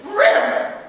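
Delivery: high, loud burst imitating the rip; explosive sound-effect word; sudden ripping shout. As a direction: shout it like tape tearing off skin. Acts out wax strip being ripped.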